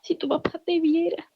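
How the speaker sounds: whispered high through real tears; choked whisper of a mother's words; tearful whisper. Real, not acted. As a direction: barely get the words out, crying. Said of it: "Me emociona".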